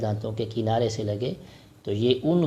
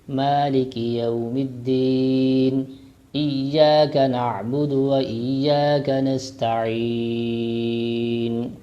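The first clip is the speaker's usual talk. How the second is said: slow chanted recitation; tajweed recitation; Quranic recitation; much slower than usual. Shown as a demonstration. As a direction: recite slowly and melodiously. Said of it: "اب شروع سے ہم پڑھتے ہیں".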